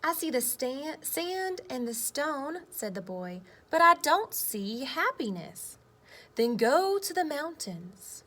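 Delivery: lively picture-book read-aloud with voiced boy and earth; reading to children. Teacher reading a story to her class, lively read-aloud.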